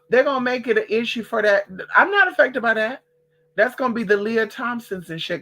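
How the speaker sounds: ordinary talk, nothing marked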